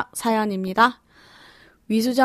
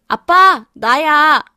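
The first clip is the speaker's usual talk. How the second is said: loud, high call across the arrivals hall; shouting to dad; 6 semitones higher, 9 dB louder, much slower than usual. Retold or imitated. Daughter calling out loudly to her father at the airport. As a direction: yell out to get dad's attention. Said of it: "라고 소리치니".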